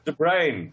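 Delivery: "De Bruyne" as "de Brain"; De Bruyne said right. Shown as a demonstration. Asked by the host, shows the right way. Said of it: "how did you pronounce it, [name]?".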